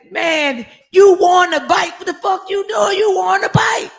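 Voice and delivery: high, loud shouted news; excited shouting. Retold or imitated. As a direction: yell it like you can't believe it. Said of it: "he said".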